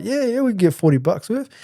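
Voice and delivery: keen and high, like sealing a deal; eager dealer. Retold or imitated. Camo guy agreeing to forty bucks worth, in a high put-on voice.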